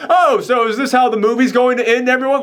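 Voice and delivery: high, intrusive mock announcement; fourth-wall-breaking loudmouth. Annoying character interrupting the movie's ending, high and showy. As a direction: jump in high, playing to the whole audience.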